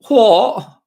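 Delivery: put-on a five-year-old parroting the TV footballer; kid copying Jamie. Dad imitating his kindergartner answering about cereal, loud high mock yell. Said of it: "my kindergartner goes around".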